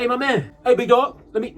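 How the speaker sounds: loud, high hawker call; imitated pushy vendor. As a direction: hail a passer-by like a pushy cleaner. Pushy shoe cleaner hailing passer-by.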